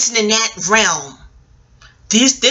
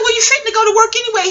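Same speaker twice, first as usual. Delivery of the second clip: high, fast retort; 11 semitones higher, faster than usual. Retold or imitated. Retold reply to ex-husband.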